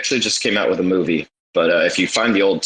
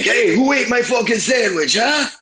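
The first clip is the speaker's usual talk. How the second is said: screechy squeal of outrage at the stolen sandwich; angry Carl. Retold or imitated. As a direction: yell it like a furious cartoon neighbour. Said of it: "Carl comes out of it and he's like".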